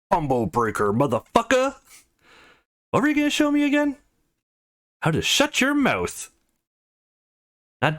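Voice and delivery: high, cocky trash talk; gloating taunt. Acted. Trash talk during a fight game.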